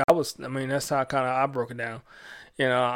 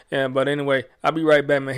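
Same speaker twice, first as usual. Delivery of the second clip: ordinary talk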